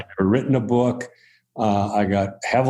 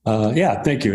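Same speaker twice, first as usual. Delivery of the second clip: ordinary talk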